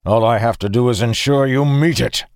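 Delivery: heated, forceful menace; snarling threat. A narrator's reading. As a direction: threaten with fierce resolve.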